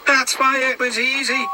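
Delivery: high, put-on engine voice, sorry for himself; a high little engine voice full of self-pity; James the engine, glum. James alone in the shed, high glum engine voice. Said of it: "said James".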